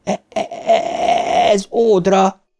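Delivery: long stammer bursting into a high cry; choked, stuttering despair; desperate stutter. A narrator's reading. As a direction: struggle on the first sound, then cry out. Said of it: "kiáltotta kétségbe esetten Bill".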